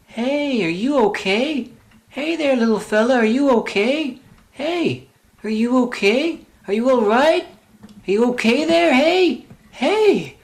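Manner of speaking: high, soft coaxing to a small creature; coaxing little fella. Acted. Calling softly to a small creature, high and gentle.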